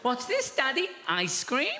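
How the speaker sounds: put-on high, innocent toddler asking; a curious little girl's question. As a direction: daughter offering her dad a handful, high and innocent. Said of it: "She goes".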